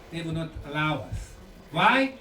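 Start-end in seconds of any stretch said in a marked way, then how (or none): none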